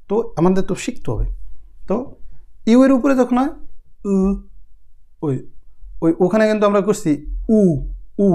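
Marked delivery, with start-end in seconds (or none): 4.1-4.1 উ said as a rounded German ü, lips tight and tongue forward; ü demo; German ü sound. Shown as a demonstration. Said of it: "ইউ এর উচ্চারণ উ". 7.6-8.3 উ said as a rounded German ü, lips tight and tongue forward; ü demo; German ü sound. Shown as a demonstration. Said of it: "ইউ এর উচ্চারণ উ".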